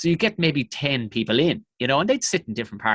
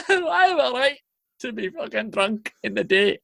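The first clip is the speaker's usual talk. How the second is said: put-on high-pitched, wailing drunk woman; hammed-up tearful high voice; mock crying woman; 9 semitones higher than usual. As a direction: imitate a weepy woman protesting. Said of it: "just starts wailing, crying".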